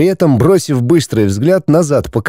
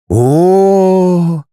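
drawn-out mock respect; 6 semitones higher than usual. A narrator's reading. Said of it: "уважительно протянул Дмитрий".

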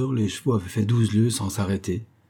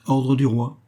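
firm, raised announcement of an order; autorité; 5 semitones higher, 4 dB louder, slower than usual. A narrator's reading. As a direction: state the royal order with authority. Présente un ordre écrit. (ton ferme et autoritaire)

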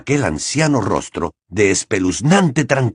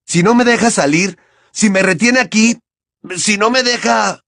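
desperate threat; 11 semitones higher, 3 dB louder than usual. A narrator's reading. Harry threatening.

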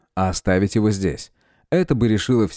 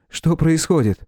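raised, worried question; higher anxious young man; 7 semitones higher than usual. A narrator's reading. Neighbour asks anxiously.

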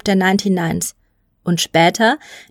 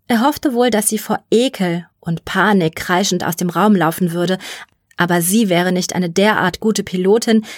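ordinary delivery

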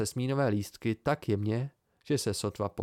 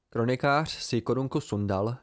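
usual speaking voice

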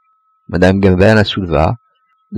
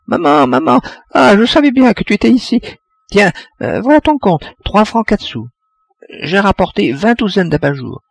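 put-on high, piping little girl, much higher; piping child voice; 12 semitones higher than usual. A narrator's reading. Little girl bursting in to her mother, high piping voice. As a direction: chirp it out like a ten-year-old girl. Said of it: "de sa voix flûtée".

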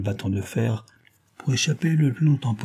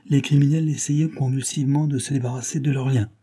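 usual speaking voice

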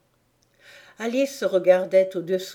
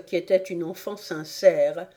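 plain reading voice

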